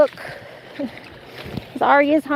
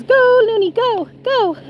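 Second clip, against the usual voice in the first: cheering; 10 semitones higher, 3 dB louder, slower than usual. Retold or imitated. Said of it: "Doctor Who says".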